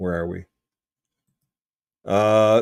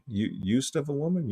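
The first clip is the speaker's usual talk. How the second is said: softer, puzzled echo as a question; incredulous query; 3 semitones higher, 7 dB quieter than usual. Real, not acted. Host doubting the dictionary note, incredulous query. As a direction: question the phrase in disbelief. Querying what he just read.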